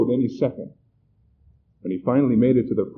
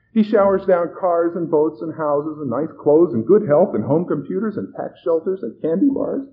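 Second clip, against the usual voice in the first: gushing; 7 semitones higher, 6 dB louder than usual. A narrator's reading. Plump woman telling the host of her gifts, gushing. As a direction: reel off the blessings with relish.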